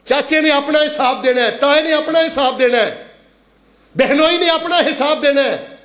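high, ringing preacher's appeal; fervent sermon cadence, pitched high; impassioned exhortation. Real, not acted. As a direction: urge the congregation in a high pitch.

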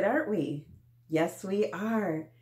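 ordinary talk, nothing marked

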